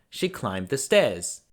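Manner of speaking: ordinary delivery, nothing marked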